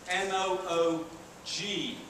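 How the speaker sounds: normal talking voice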